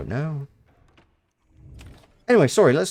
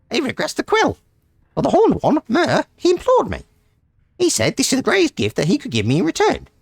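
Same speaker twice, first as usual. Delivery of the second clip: much higher, squeaky little creature; high, squeaky voice of a stubborn little creature; 11 semitones higher, faster than usual. Acted. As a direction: pitch way up like a tiny odd beast recounting its tale. Voices Haoub the Swallow's journal.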